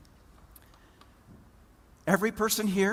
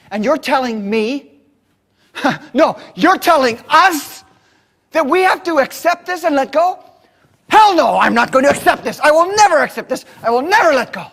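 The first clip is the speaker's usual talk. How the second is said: higher and louder, defiant; furious refusal; 8 semitones higher, 13 dB louder than usual. Retold or imitated. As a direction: hurl it back at the speaker, defiant. Angry farmer refuses to accept.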